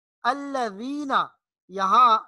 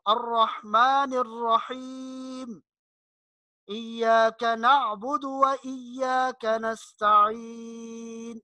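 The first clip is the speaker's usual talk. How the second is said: Quran recitation; 2 semitones higher, much slower than usual. Shown as a demonstration. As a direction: recite measuredly with long held vowels. Recites verses as model. (ٹھہر ٹھہر کر تلاوت)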